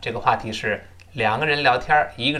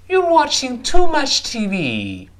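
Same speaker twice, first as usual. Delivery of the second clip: "You're watching too much T V" high, slow and loud, falling at the end; light "You're", then stretch and punch "watching too much T V"; exaggerated stress and intonation; 11 semitones higher, 5 dB louder, slower than usual. Shown as a demonstration. Teacher asks students to read aloud, "You're watching too much T V" high with exaggerated stresses. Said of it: "注意轻重音".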